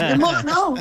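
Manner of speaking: half-laughing retold victory yell; retold crazy yell. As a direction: imitate your own wild cheer through a laugh. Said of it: "me pongo a gritar como loca".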